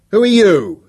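high, loud challenge; loud, carrying voice. A narrator's reading. Speaker challenging the professor, loud and carrying. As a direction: project it over the crowd. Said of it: "He said it loudly".

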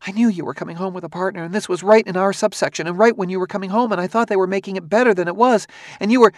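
high, rushed voice of a worried wife; hurried. A narrator's reading. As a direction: rush it out high-pitched, piling phrase on phrase. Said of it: "She said in a hurried whisper".

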